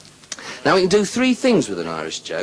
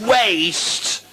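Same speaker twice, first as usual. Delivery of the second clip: high parade-ground yell; sergeant's drawn-out order; 8 semitones higher than usual. Acted. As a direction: call it out like an army sergeant. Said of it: "the sergeant says".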